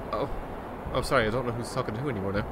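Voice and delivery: ordinary delivery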